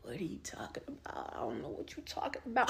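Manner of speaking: whispered, playing dumb in a low voice; mock his fake innocence in a hush. Imitating the boyfriend denying everything, in a mock whisper. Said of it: "He like".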